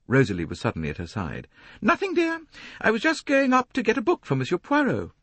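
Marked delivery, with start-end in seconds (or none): 1.8-5.1 much higher, a self-important lady complaining; a fussy society matron, preening. A narrator's reading. Mrs Otterbourne voiced by the narrator.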